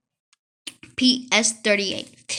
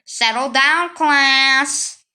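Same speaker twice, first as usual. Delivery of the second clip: a firm teacher calling the room to order, louder; 7 dB louder, much slower than usual. Retold or imitated. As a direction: bark it like a teacher quieting the class. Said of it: "the time I hear".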